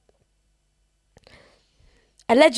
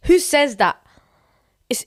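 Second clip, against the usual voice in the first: ordinary delivery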